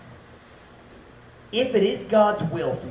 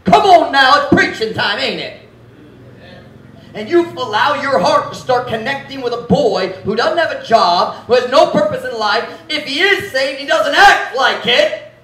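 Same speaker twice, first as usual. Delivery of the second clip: high, loud, fired-up preaching; preaching at full force; 11 semitones higher, 11 dB louder than usual. Acted. Pastor rousing the young women in church, loud preaching. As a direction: lift the room, pastor in full cry.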